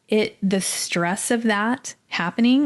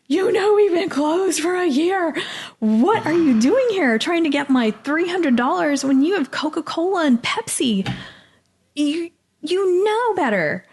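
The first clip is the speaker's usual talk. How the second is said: tearful scolding; 10 semitones higher than usual. Retold or imitated. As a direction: scold him as you fall apart. Scolding the Cintas man.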